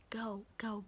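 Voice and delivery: hurried hushed whisper. Retold or imitated. Coworker whispering at her to go hide. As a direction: urge her off quietly.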